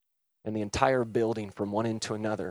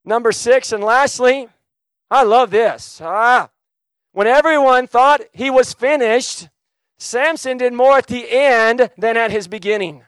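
loud, high, impassioned preacher delivery; full-force preaching; 10 semitones higher, 12 dB louder than usual. Real, not acted. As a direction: preach it out to the whole room.

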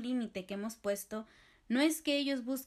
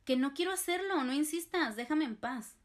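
aggressive reply; 5 semitones higher than usual. Shown as a demonstration. To a friend who keeps prying, exasperated angry outburst. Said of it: "Una respuesta agresiva sería algo como".

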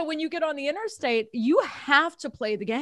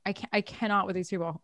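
mock exasperated mutter; 5 semitones lower, 7 dB quieter, faster than usual. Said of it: "I'm like".

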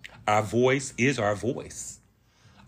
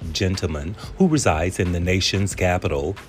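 louder, polished host read; podcast intro announcer; 3 semitones lower, 6 dB louder than usual. Acted. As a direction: sell the show like a radio promo. Podcast intro read over music.

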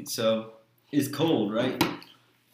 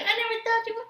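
much higher, girlish mock voice; imitating Sarah; faster than usual. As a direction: squeak it out like a teasing girl. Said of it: "And she's gonna be like".

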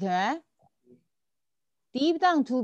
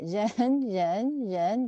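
人 said on its own three times, each clearly stressed; stressed 人 drill; much slower than usual. Shown as a demonstration. Teacher drilling 人 for the students, stressed each time. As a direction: drill the word 人 slowly and firmly. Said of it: "don't wanna forget to add 人 after it".

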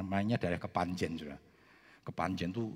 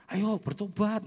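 calling to repent; 11 semitones higher, 4 dB louder, slower than usual. Retold or imitated. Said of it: "Saya bilang".

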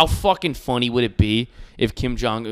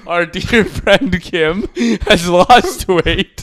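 slow, drawn-out, louder announcer voice; hammed-up state-TV praise; mock solemn tribute; 5 semitones higher, 6 dB louder, much slower than usual. Podcast host hails dictator's weight loss in slow mock-solemn voice.

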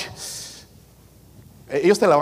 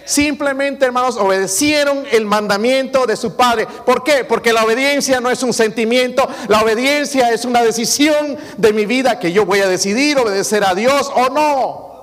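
high, loud emphatic sermon; fiery preaching; 10 semitones higher, 6 dB louder than usual. Acted. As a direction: proclaim it to the church. Preaching at full force.

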